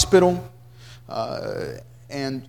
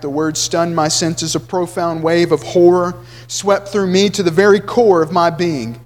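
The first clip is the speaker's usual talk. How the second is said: ordinary talk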